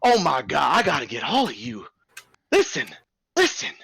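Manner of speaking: a fast-talking circus boss buttering up the bears; ringleader voice. Acted. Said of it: "he just stops and he goes".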